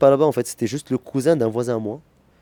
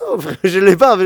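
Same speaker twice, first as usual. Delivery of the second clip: higher and louder, insisting; emphatic denial; 8 semitones higher, 10 dB louder than usual. Retold or imitated. Answering the director who thinks he fakes an accent, loud insisting. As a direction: push back loudly on the director's claim.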